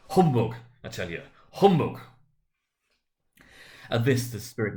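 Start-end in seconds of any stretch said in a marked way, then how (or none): 0.1-2.0 loud, rising scoff on the second humbug; scornful humbug. A narrator's reading. Scrooge loudly scoffs at the ghost. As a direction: spit out the dismissal.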